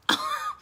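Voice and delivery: short high laugh; squealing high laugh. Real, not acted. Admitting she is self-focused too, squeal of laughter. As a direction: let a giggle fly up.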